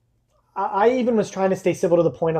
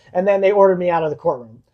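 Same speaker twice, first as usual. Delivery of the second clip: ordinary delivery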